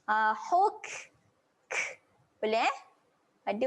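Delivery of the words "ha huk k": "huk" ending with air on the k; airy kaf. Shown as a demonstration. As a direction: release breath after the k in "huk". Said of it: "Kaf mati dia kena keluar angin". ("huk" keluar angin pada kaf)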